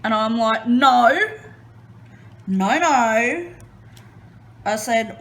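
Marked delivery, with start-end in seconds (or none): 0.7-3.5 loud frantic refusals, pitch up; flailing in bed, panicked and loud. Retold or imitated. Wife to husband about a bug, panicked and loud.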